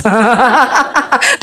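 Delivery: rippling run of laughter; real laugh. Real, not acted. Preacher jokes about people who change numbers, real laugh. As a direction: laugh at your own joke.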